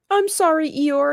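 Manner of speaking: high, teary little apology; mock tearful. Crying Piglet apologizes to Eeyore, mock tearful. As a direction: lightly imitate a crying Piglet. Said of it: "And he's crying".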